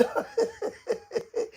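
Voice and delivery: a squeaky delighted giggle; quick high laugh breaking out. Real, not acted. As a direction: burst of high-pitched laughter. Laughing at a joke on air.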